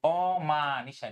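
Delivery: অমানিসা stretched very slowly, syllable by syllable; slow example word. Shown as a demonstration. Teacher giving students an example word, very slow. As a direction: draw out অমানিসা so students hear each sound. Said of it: "যেমন".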